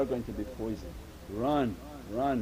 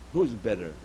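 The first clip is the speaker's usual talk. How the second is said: ordinary talk, nothing marked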